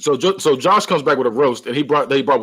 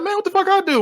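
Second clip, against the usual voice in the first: whiny protest. Retold or imitated. Josh retold protesting to his brother.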